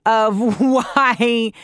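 slow laughing repeat of crack that code. Real, not acted. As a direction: giggle through crack that code, no pirate. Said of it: "why did I sound like a pirate".

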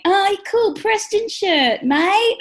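high, stretched-out imitation of a kid; slow, high-pitched mock kid's cheer; admiring kid. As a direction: mimic an impressed child calling out. Voices kids admiring son's shirt.

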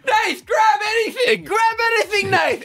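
very high-pitched panicked-sounding yell; retold scream. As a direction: re-enact screaming an urgent order at a friend. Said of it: "I just scream at Nath".

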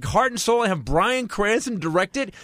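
high-pitched, drawn-out outrage; comic indignation, high and slow; incredulous rant. Retold or imitated. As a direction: stretch each phrase in exasperated disbelief. Rants in disbelief about the barista.

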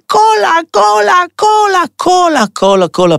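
high-pitched demanding chant, easing off at the end; mock child's screaming. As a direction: imitate a child screeching for a treat. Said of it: "הוא צורח". (צרחות ילד בחיקוי, בקול גבוה)

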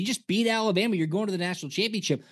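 high, excited hype voice; mock celebration. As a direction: pump it up like a fan rushing the field.

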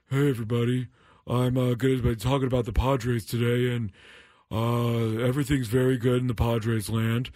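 mock deep businesswoman voice, lightly done; deep put-on voice. Shown as a demonstration. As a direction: drop into a fake deep voice. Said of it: "it would be like if I went like this".

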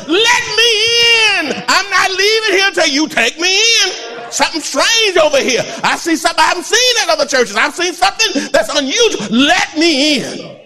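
high shouted plea, nearly crying; pleading shout. Retold or imitated. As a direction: cry out to be let in at full force. Preacher voices sinner begging loudly.

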